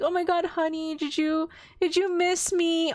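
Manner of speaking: high, sweet-talking wife on the phone; gushing mom. Retold or imitated. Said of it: "the mom answers. She's like".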